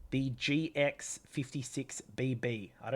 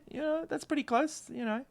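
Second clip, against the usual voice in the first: squeaky high voice; 13 semitones higher than usual. Acted. Squeaky aside after near miss.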